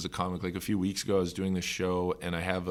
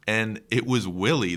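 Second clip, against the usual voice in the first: usual speaking voice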